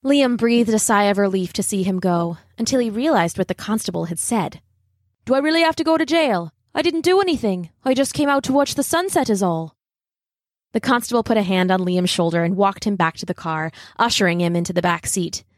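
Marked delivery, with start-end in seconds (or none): 5.3-9.8 high, fast anxious pleading; a boy scared he's going to jail; worried boy. Acted. Boy pleads with the constable, high and fast.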